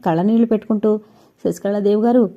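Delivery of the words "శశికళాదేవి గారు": usual speaking voice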